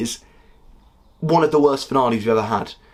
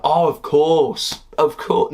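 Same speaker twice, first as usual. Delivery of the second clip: mock dawning realization; 4 semitones higher, much slower than usual. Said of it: "be able to pick up on and be like".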